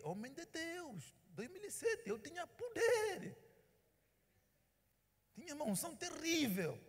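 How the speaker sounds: high, slow and rising, building to a loud peak; proud proclamation. Retold or imitated. Pastor boasting of his anointing, high ringing proclamation. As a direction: proclaim it like a preacher at full fire.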